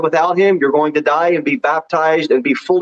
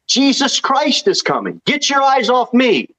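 higher, urgent herald's cry; proclaiming cry; 9 semitones higher than usual. Acted. Preacher voicing John to the Pharisees, urgent cry. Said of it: "And here's my cry".

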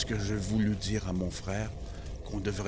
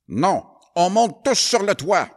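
loud, high command; firm order; 11 semitones higher, 11 dB louder than usual. Acted. Brother orders all to the roof. (ordre ferme et fort)

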